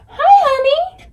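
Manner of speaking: very high-pitched hello to a pet; cooing greeting. Real, not acted. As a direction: coo it in a high, doting voice. Greeting Callie on arrival.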